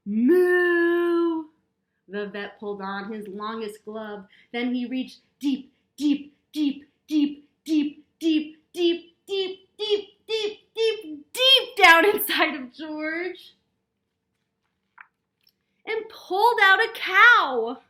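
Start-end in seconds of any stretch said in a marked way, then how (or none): 0.1-1.5 very loud, higher cow moo. A narrator's reading. Puppy George mooing at the vet, loud. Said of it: "George went". 4.5-17.8 getting higher and louder to a shouted reveal; building suspense. A narrator's reading. Storyteller building the vet's reach, rising to loud.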